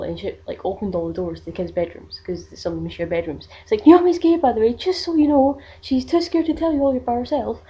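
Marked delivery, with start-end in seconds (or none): 3.9-7.6 announcing loudly, much higher and louder; calling out. Retold or imitated. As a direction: call it down the hall to every bedroom. Aunt calling down the hall.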